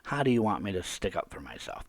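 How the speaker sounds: ordinary delivery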